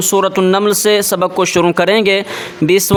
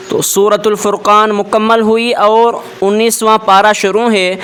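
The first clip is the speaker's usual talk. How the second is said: ordinary delivery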